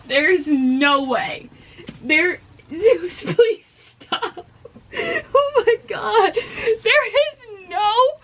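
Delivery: loud, high laugh-crying. Real, not acted. Reader overwhelmed by a book twist, crying with laughter. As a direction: sob and laugh through drawn-out disbelief. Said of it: "Why am I already crying?".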